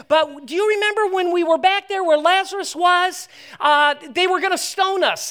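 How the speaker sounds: high, loud comic protest from a panicking disciple; panicked meltdown. Acted. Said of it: "The disciples have a meltdown".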